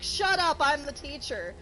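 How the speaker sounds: retold snap from a teacher shutting students down; snapping teacher. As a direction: mimic an irritated teacher pulling rank.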